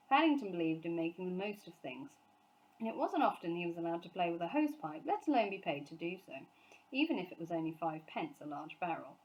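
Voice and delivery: plain reading voice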